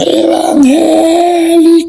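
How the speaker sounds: high drawn-out mock jeer; mocking village taunt. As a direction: sing out the insult like the mocking townsfolk. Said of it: "el pueblo me gritaba".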